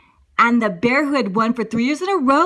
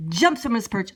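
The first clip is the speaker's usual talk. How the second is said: ordinary delivery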